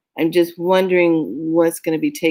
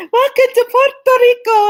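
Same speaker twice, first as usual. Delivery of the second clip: much higher and louder, a bright singsong welcome; greeter voice; 7 dB louder than usual. Retold or imitated. Airport staff welcoming arriving passengers, high singsong greeting. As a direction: mimic a cheerful airport greeter. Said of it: "They smile at you, say".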